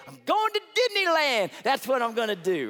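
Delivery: high, loud ad-style cheer; excited winner. Pretend. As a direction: shout it like a champion's ad. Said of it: "you expect him to say".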